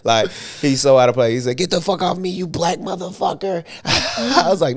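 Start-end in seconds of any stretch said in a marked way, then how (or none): 1.6-3.6 high-pitched roommate imitation, not shouted; mock angry outburst. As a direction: replay the pinned man's protest for laughs.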